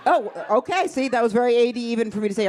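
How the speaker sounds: ordinary delivery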